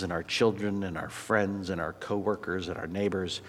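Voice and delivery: soft, earnest prayer voice; closing prayer. Real, not acted. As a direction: pray quietly and sincerely to God. Pastor leads closing prayer.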